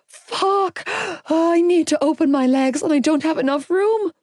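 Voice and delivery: high, pained desperate pleading. A narrator's reading. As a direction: beg through the pain. Labour pain, pleading.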